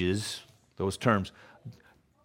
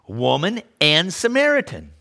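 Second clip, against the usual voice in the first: usual speaking voice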